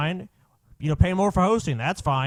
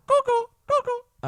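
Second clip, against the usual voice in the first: very high, slow cuckoo noise; mocking cuckoo call; slower than usual. Co-host calling the ranter crazy, mocking cuckoo call. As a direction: imitate a cuckoo clock to call someone crazy. Imitates cuckoo to mock Jones.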